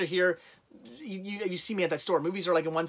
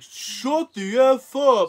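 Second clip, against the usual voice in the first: drawn-out, high, loud mock yell; slow, stretched-out shout; imitated yell; 7 semitones higher, 7 dB louder, much slower than usual. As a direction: imitate an angry stranger bellowing. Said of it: "started yelling at you".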